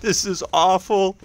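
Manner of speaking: high-pitched, helpless amusement; laughing dismay. Real, not acted. Spectator watching an awful play, high laughing dismay. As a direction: squeal it high, as if cracking up.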